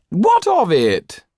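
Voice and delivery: slow, high, loud brush-off; dismissive. A narrator's reading. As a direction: wave it away as beneath him. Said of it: "with a dismissive wave".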